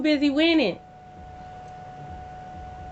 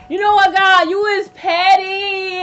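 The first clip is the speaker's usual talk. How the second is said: much higher and louder, slow, with a cry in it; mock outraged shout; 7 semitones higher, 7 dB louder, slower than usual. Real, not acted. Reader jokingly shouting at God, high and slow. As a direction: yell at the sky in playful outrage.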